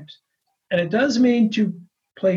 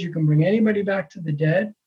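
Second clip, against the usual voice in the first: normal talking voice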